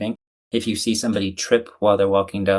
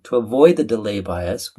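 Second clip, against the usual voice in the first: ordinary talk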